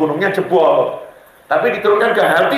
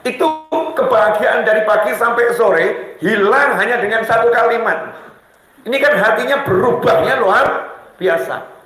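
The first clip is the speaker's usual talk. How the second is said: normal talking voice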